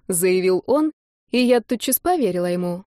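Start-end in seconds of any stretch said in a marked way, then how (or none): none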